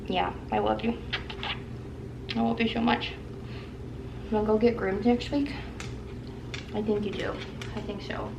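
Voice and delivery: soft tender coo; talking to a dog. Real, not acted. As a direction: gently reassure the pet. Cooing to her dog.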